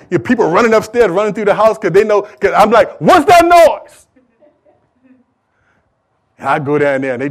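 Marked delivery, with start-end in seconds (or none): 3.0-4.1 high, loud, stretched-out demand; shouting father. Retold or imitated. Preacher as father yelling upstairs after a crash, loud shout. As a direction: holler it through the house.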